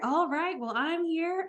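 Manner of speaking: calm her down gently; reassuring. Retold or imitated. Reassuring the scared lady.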